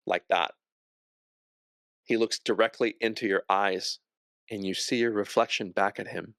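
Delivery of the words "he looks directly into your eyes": normal talking voice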